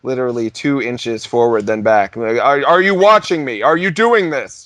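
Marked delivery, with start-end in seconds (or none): 2.4-4.6 mock angry DJ. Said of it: "Just like an angry".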